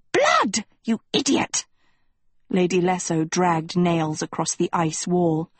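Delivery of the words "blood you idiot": shrill contemptuous snap; scornful shout. A narrator's reading. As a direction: spit the answer at her. Lady Lesso scolding Dot.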